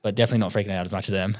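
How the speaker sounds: ordinary delivery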